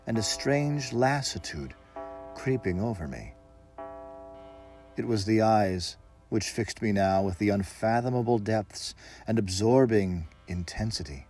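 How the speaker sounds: usual speaking voice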